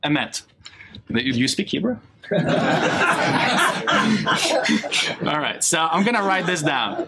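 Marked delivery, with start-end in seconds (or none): 2.3-5.2 a bright burst of laughter; real laughter, higher; amused laugh. Real, not acted. Laughs after asking.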